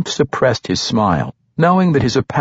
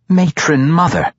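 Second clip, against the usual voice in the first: a sharp, louder, higher snap of correction; 9 semitones higher, 4 dB louder than usual. A narrator's reading. Quenthel sharply corrects Grumpf's familiar address, snapping. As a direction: cut him off with the title, raised and firm. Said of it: "Quenthel sharply corrected".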